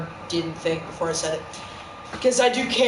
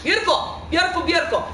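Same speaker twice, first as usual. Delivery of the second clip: delighted; 9 semitones higher, faster than usual. Real, not acted. Delighted at one viewer.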